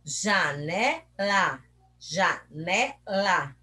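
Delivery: JANELA very slowly in three pieces, ja... ne... la; slow teacher's split of JANELA with pauses; syllable by syllable. Shown as a demonstration. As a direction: chop JANELA into three separate beats. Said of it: "ela possui três pedacinhos".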